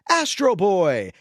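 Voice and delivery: high, drawn-out mock shout; crowd's mock yell. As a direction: imitate a pointing crowd calling out, stretched slow. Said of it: "a crowd of people point at him and yell".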